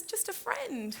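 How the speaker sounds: high, slow, pleading reassurance; pleading excuse; placating. Acted. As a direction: soothe a jealous friend, drawing it out. Placating a jealous friend.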